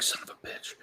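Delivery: whispered under the breath; muttered whispered curse. Retold or imitated. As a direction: curse in a bare whisper to yourself. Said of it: "I'm just like".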